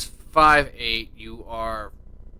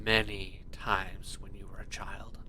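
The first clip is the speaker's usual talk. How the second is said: soft scene narration; 5 semitones lower, 14 dB quieter than usual. A narrator's reading. GM sets scene softly.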